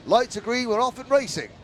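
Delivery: high, loud start call; excited race call. Real, not acted.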